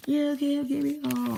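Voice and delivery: high, loud character voice; acted character outburst. Pilot facing mini ships, high loud outburst.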